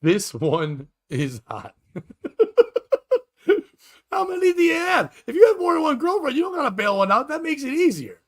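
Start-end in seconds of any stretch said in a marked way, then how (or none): 4.1-7.6 high, loud disbelieving shout; incredulous shout. Real, not acted. Incredulous laughing outburst.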